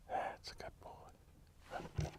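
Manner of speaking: breathy whisper, drawn out for the dog; slow whispered praise. Real, not acted. Trainer whispers praise to the dog, slow whisper. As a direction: whisper it slowly, just for the dog.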